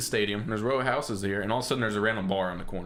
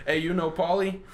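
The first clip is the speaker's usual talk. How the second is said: high-pitched imitated Boston question; mock Boston local, pitched up; bad Boston accent; 8 semitones higher than usual. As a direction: ask it in a rough Boston accent. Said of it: "I can't do a good Boston accent".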